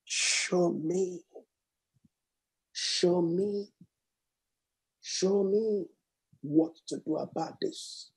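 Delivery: soft, slow pleading prayer; quietly ask, almost breathing it; soft prayer fading to a whisper; hushed prayer. Retold or imitated. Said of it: "He said".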